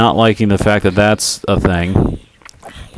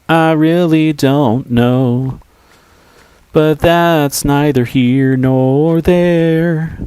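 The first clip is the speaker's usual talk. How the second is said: high drawn-out voice; 7 semitones higher, much slower than usual. Acted. Musing aloud, drawn out.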